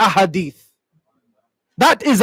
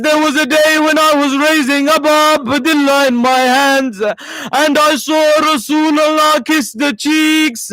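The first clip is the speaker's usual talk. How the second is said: weeping memory; 5 semitones higher than usual. Acted. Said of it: "Habib begins to cry".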